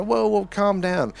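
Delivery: high, slow soothing whoa; slow, high mock soothing; calming down. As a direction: draw it out slowly to calm them. Calming an overreacting person.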